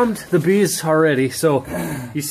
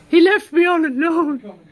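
mock whiny complaint; 11 semitones higher, 3 dB louder than usual. Mock self-pity, raised pitch.

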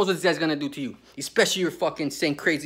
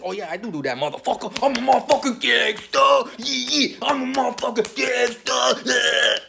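higher, loud shouted tough-guy brag; mock gangster boast; 7 semitones higher, 4 dB louder than usual. Imagined killer bragging back at the visitor. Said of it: "see what the person says".

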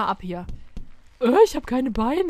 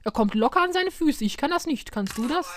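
normal talking voice

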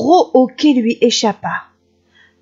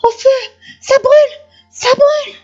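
very high, loud yelp of pain; wolf stung by nettles yelping in pain; 6 dB louder than usual. Acted. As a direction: howl it in panic.

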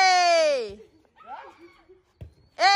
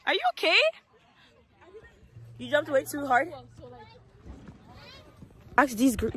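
ordinary talk

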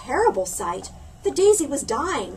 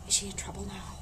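worried aside; 4 semitones lower, 9 dB quieter, faster than usual. Acted. Reader asks children in suspense.

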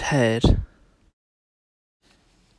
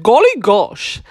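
high, loud exclamation; farmer's astonished cry; startled farmer; 6 semitones higher, 7 dB louder than usual. Acted. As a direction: blurt it out in surprise.

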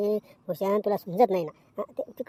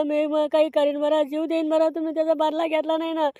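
tearful wailing; 5 semitones higher than usual. Acted. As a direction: wail your complaint, stretching the words into sobs. Beaten boy wails to his father.

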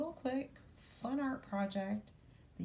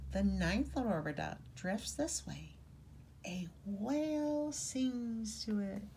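put-on reading to children; 2 semitones lower than usual. A narrator's reading. Reading picture book aloud.

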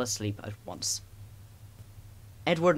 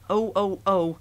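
higher, louder startled cries; trucks screaming; 7 semitones higher, 5 dB louder, slower than usual. A narrator's reading. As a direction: cry out like jolted trucks. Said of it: "screamed the trucks".

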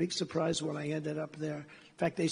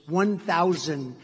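slow, loud automated cockpit voice; computer voice; 8 dB louder, much slower than usual. Retold or imitated. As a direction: stretch it out like a machine readout. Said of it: "And it's a computer voice".

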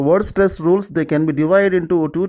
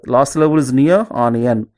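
ordinary talk

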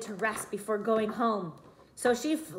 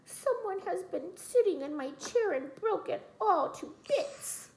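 high, soft whisper fading out; whispering Baby Bear; 8 semitones higher, 5 dB quieter than usual. A narrator's reading. Baby Bear whispers that his chair is broken to bits. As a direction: breathe it, heartbroken and small. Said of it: "And Baby Bear whispered".

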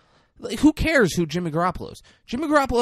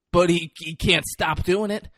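ordinary talk, nothing marked

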